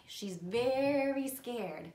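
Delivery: "very" drawn out long and loud; stress on very. A narrator's reading. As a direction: stretch out "very" for emphasis. Stressing very to children.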